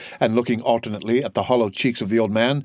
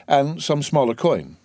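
plain reading voice

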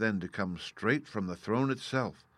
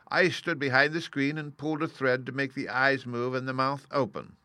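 high-pitched character voice; explaining little man; 6 semitones higher, 4 dB louder than usual. Acted. Little man explaining his trick, high-voiced.

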